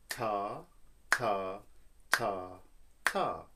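even ta on each beat; rhythm syllables. Shown as a demonstration. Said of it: "this rhythm says".

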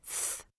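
"th" alone as a voiceless tongue-between-teeth sound. Shown as a demonstration. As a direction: isolate "th" as in thing. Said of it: "The first sound is".

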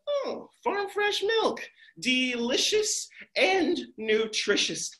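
high, slow savoring wonder; delighted Jack. Acted. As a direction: marvel at the milk. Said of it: "Jack's like".

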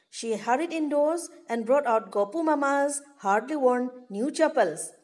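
ordinary talk

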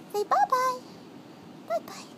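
high, soft cooing goodbye. Real, not acted. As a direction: coo goodbye to a kitten. High farewell to kitten.

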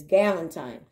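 galantine with a long i ending; rhymes with Valentine. Shown as a demonstration. Vlogger explaining the spelling, galantine said like Valentine. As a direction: say galantine as GAL-un-tine. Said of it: "just like Valentine".

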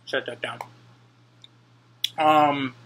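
talking while chewing fruit; muffled words between bites; mouth full, chewing. Real, not acted. Seen 1: Bites pineapple at -2; lips closed, jaw working until about 5s.

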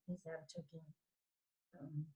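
hushed, quick demonstration of talking softly; soft talking demo. Shown as a demonstration. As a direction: drop the voice to show soft speech. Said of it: "softly or I'm talking very loud".

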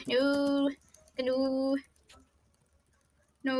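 normal talking voice

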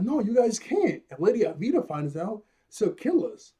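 mock panic as nervous Kobe; panicky Kobe. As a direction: beg them not to drink it. Kobe panics about Lady Avita.

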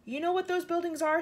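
mildly shouted, high and excited. A narrator's reading. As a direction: ask it eagerly, pitched well up. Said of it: "shouted James".

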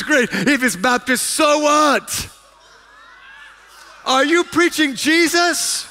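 loud, high sermon delivery, driving each question home; fervent preaching. Acted. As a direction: preach it at full force to the congregation. Preaches at full force.

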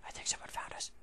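whispered, no voice at all. Real, not acted. Gamer hiding from a found enemy, whispering. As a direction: breathe it like hiding from someone.

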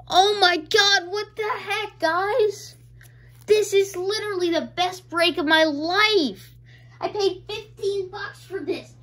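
high, thrilled exclaiming; elated amazement. Real, not acted. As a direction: gush in real, overjoyed disbelief. Celebrates best break of life.

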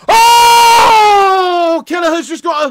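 sudden loud high yelp; real startled cry; surprised shout. Real, not acted. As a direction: gasp at the near miss. Near miss.

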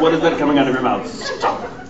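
playful astonished scolding; mock disbelief. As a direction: lightly imitate a woman demanding an explanation. Imitating the astonished concierge woman.

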